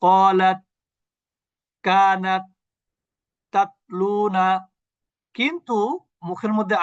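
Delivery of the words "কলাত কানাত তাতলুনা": কলাত, কানাত, তাতলুনা each with the t locked shut; no breath released on the t sounds; held without air. Shown as a demonstration. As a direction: trap the air on every t. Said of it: "পড়াটা আটকে রাখলেন".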